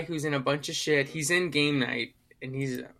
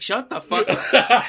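loud, high, drawn-out put-down; mock angry shout; 8 semitones higher, 7 dB louder, slower than usual. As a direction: imitate an irritated boss, slowly and loudly. Imitates character snapping at lackey.

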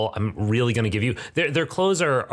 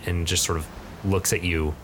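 ordinary talk

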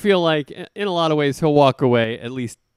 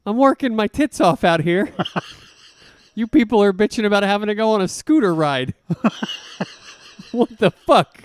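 hammed-up exasperated yelling, louder; mock rant; 7 semitones higher, 4 dB louder than usual. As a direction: rant like a fed-up coach.

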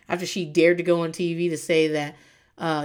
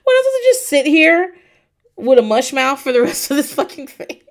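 exasperated rant; 6 semitones higher, 7 dB louder than usual. Retold or imitated.

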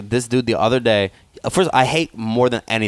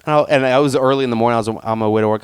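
usual speaking voice

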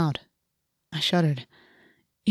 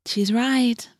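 higher, regretful agreement; mildly sad; 5 semitones higher than usual. A narrator's reading. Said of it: "Cassie said sadly".

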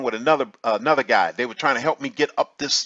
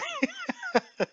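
high, light chuckle; helpless giggle; laughing; 11 dB quieter than usual. Real, not acted. Man amused by his own roll down the beach, high giggle.

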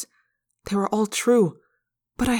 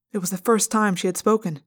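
plain reading voice

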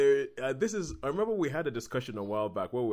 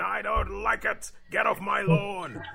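high, slow, hammed-up grumpy old-timer; mock an old man hollering at trespassers; cranky old man's drawn-out mock yell; 10 semitones higher, 5 dB louder, slower than usual. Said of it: "like an old man yelling at the sun".

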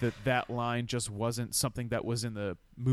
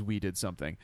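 lower, softer deadpan delivery; calm quote; 8 semitones lower, 8 dB quieter than usual. Retold or imitated. Host naming the drinking-game line, calm and low. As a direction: drop it low and casual.

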